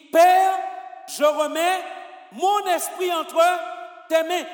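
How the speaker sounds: Père shouted high, then slow, full-voiced words to tes mains; loud with conviction. Shown as a demonstration. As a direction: proclaim it with full conviction, as a dying cry. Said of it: "quand il meurt, il dit".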